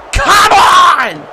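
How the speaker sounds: loud high come on; frustrated shout. Real, not acted. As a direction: yell in protest at the flag. Penalty wipes touchdown.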